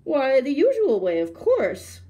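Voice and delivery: loud, high storybook holler. A narrator's reading. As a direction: holler it as an impatient tiger. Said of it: "hollered the tiger".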